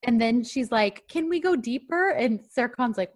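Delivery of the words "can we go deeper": high-pitched, rising retold question; eager asking. Host retells her asking the swim teacher, eager high voice. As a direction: ask it high and hopeful.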